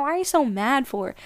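ordinary talk, nothing marked

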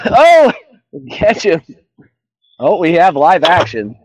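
high, loud burst of excitement; excited. Real, not acted. Angler reacting to a live bite on stream, excited. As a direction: call it out as the fish hits. Fish bites during live chat.

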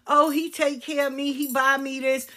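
mock smitten girlfriend. Mocking a scammed girlfriend.

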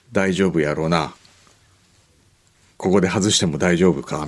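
low Kansai patriarch, lightly voiced; Kaibara Yuzan-like father. Earplugged father questioning his son, as a low-voiced Kansai patriarch. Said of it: "貝原雄山みたいな".

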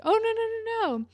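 high, loud rapid-fire protest; mock-flustered high-pitched denial; hasty denial. Retold Caitlyn hastily denying it.

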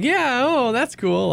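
high-pitched feigned interest; imitated naive agreement; mock playing along. Acts out playing along as a newbie.

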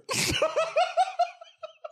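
shrieking burst of laughter; high squealing laugh. Real, not acted. Long laugh after the joke.

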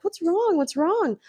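high, put-on worried question; imitated concern. Friend rushing to crying woman.

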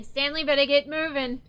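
calling out. Acted. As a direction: shout a warning across the fight. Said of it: "she's gonna yell out".

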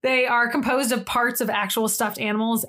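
ordinary delivery

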